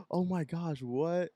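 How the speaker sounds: high-pitched imitated astonishment; gasping shock. Party guests learn someone is seventeen, mock shock. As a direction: act out a scandalized onlooker. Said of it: "Everyone started gasping".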